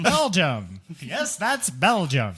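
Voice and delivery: loud, slow announcer voice; mock announcer. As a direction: savor the country name, slowly.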